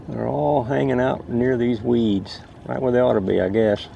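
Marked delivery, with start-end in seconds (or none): none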